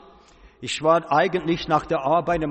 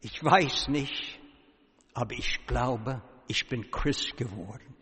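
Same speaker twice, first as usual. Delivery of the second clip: hesitant quiet confession; 4 semitones lower, 7 dB quieter than usual. Retold or imitated.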